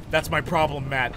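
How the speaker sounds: ordinary talk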